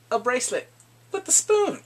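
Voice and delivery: excited reveal. Real, not acted. Telling viewers about Bong Soo's gift, excited. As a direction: build to a squeal on the spoon.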